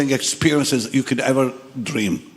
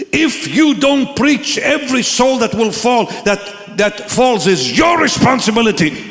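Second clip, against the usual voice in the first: guest speaker preaching; 7 semitones higher than usual. Retold or imitated. Guest speaker warns someone sitting right there, preaching forcefully. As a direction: hammer out each word in a raised voice. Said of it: "And the guest speaker looks and says".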